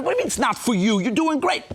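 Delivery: rising, insistent objection; incredulous protest. Retold or imitated. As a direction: talk the student out of leaving. Teachers argue against his leaving.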